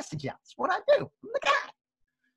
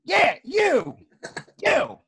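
higher, louder insistent call; insistent umpire call; 8 semitones higher, 4 dB louder, much slower than usual. Retold or imitated. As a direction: point someone out from across a field. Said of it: "I'm sorry".

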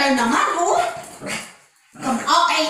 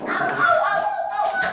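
high yelps; 13 semitones higher than usual. Real, not acted. Dog nipping her.

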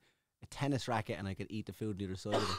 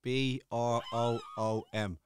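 slow spelling; much slower than usual. Pretend. Spelling answer slowly.

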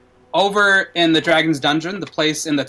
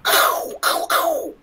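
pained cries; much slower than usual. Acted. Man shot, crying in pain.

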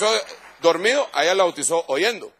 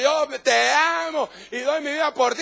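worshipful devotion; 10 semitones higher than usual. Retold or imitated. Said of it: "usted está adorándole".

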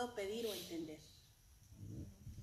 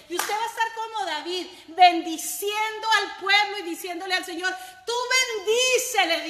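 fervent prayer; 7 semitones higher, 15 dB louder than usual. Acted. Preacher leading the congregation in prayer, at full force. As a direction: lead the church in a fiery prayer.

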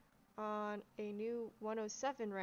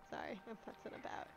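ordinary talk

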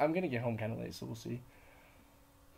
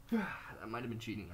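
ordinary talk, nothing marked

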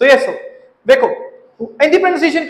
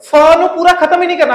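ordinary talk

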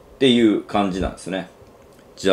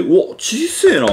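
surprised thought; 13 semitones higher, 4 dB louder, slower than usual. Retold or imitated. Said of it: "と思って".

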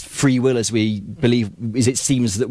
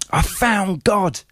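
slow, higher, changed voice, awed; solemn announcement; 8 semitones higher, much slower than usual. Retold or imitated. John telling his mum his news, slow and awed. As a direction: draw it out like a revelation. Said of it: "And he goes".

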